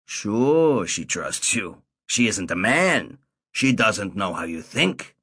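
put-on Saul warning the boy about girls; old man Saul. A narrator's reading. Old Saul voiced in story.

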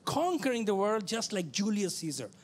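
ordinary talk

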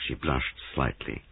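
usual speaking voice

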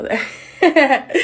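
slow, laughing through it; happy laugh. Real, not acted. Delighted with her hair for her viewers, laughing. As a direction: giggle as you look at the hairs.

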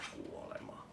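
breathy whispered word. Acted. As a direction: let it out on breath only.

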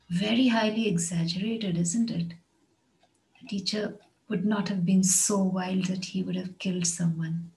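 usual speaking voice

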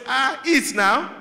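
slow, high, sweetly menacing "eat now"; sweet trap. Retold or imitated. Mother insisting on the trap at the visit, slow and high. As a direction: coax slowly, with a hidden threat. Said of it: "what your mom says".

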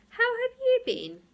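high, gentle friendly voice; a kind young hero asking; Ruskin voice. A narrator's reading.

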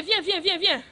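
ordinary talk

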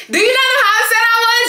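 high, fast mock crying; mock wail. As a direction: wail it like a fake sob. Exaggerated upset outburst.

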